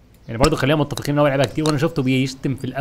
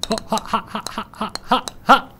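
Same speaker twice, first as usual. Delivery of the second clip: nervous laugh; 7 semitones higher than usual. Real, not acted. (ضحكة متوترة عالية وخفيفة)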